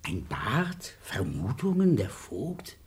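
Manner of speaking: stunned breathless whisper. Acted. Baron murmuring the clue to himself, whispered. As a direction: whisper it to yourself in shock.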